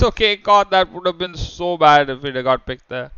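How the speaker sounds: esports caster calling the fight; energetic shoutcaster commentary; live play-by-play. Acted. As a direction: call the action as it unfolds.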